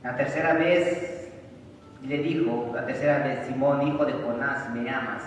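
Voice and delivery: loud, slow preaching of the third question; full-force preaching. Acted. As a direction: declaim it slowly and loudly to the congregation. Preacher reads Gospel at full force. (predicación lenta y fuerte)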